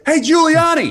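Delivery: high, loud call across the street; retold cabbie yell. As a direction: holler it like an angry cab driver. Retold cab driver yelling at Giuliani.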